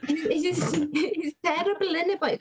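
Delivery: high, lilting Welsh accent. Shown as a demonstration. Host showing the learners again, exaggerated Welsh accent. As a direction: pitch it up with a Welsh lilt. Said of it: "the accent, I think you have to say".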